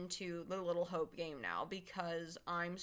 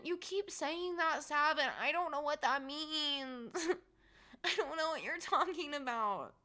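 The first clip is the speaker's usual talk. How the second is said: teary; 5 semitones higher, 4 dB louder than usual. Real, not acted. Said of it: "I'm really emotional right now".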